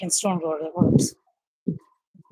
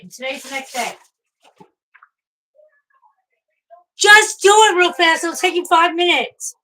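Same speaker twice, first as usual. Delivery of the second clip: scolding; 7 semitones higher, 10 dB louder than usual. Real, not acted. Mom scolding her son for not sweeping: loud angry scolding. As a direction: lay into him about the chores.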